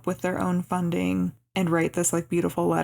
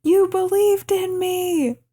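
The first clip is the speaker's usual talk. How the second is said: high, loud, drawn-out mock scream; retold scream; 11 semitones higher, 5 dB louder, much slower than usual. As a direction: yell it down the hallway, stretching the words. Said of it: "then she screams".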